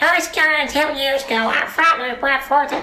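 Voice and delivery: very high-pitched squeaky little cartoon kid; piping mock Butters voice, far above natural pitch; Butters impression. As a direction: recite a famous speech in a silly, high child's voice. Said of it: "gave the Gettysburg Address in that voice".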